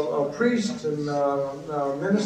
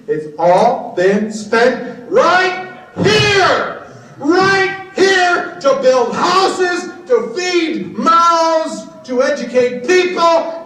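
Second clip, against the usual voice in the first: loud, high, slow shout; 10 semitones higher, 6 dB louder, much slower than usual. Real, not acted. To the audience about space money, furious shout. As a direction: hammer each phrase out furiously. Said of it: "if you want me to go mad".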